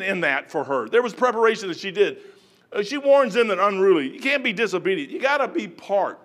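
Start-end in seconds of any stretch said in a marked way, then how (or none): none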